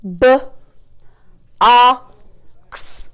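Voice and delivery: "B-, ox" split with long gaps; chopped word. Shown as a demonstration. Teacher chopping a word for pupils, sounds split apart. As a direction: chop BOX sound by sound. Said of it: "let's chop".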